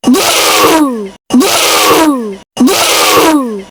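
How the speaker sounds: loud, desperate repeated no; yelling. Real, not acted. Gamer yelling at the screen as he loses, loud shouts. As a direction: shout it out in dismay. Yelling as the game goes wrong.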